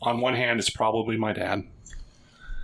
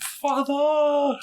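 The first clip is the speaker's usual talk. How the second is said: much higher, loud cry to his father; anguished cry; 5 dB louder than usual. Acted. Son sees his frozen father, crying out loud. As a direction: call out to him in shock.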